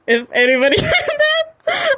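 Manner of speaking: words squeezed out through high laughter; apologizing while still cracking up; laughing hard. Real, not acted. Streamer apologizing to chat while laughing helplessly. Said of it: "I'm sorry".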